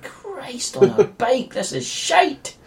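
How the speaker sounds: high, slow mock groan of despair; exasperated viewer. Viewer moaning at the film, high and slow. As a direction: whine it out like a fed-up viewer. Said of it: "You're like".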